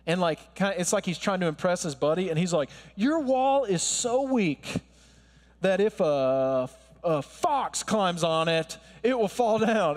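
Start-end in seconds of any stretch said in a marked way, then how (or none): 2.9-10.0 mocking lame insult. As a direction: play a weak bully showing off to his buddy. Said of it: "throws in a lame insult".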